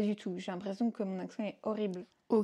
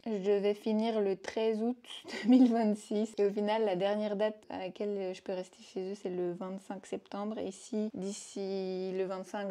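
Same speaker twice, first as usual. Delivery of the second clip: usual speaking voice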